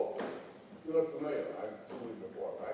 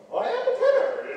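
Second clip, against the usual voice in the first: much higher and louder, a proud operatic tenor; pompous tenor; 8 dB louder than usual. Retold or imitated. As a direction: puff up like a vain opera tenor. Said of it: "Have you ever seen a tenor?".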